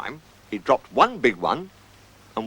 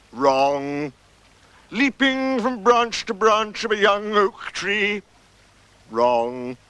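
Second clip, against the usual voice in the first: slow, drawn-out guessing game; string out mock guesses in a raised singsong; ponderous sarcasm, dropping for the verdicts; sarcastic Eeyore; 7 semitones higher, slower than usual.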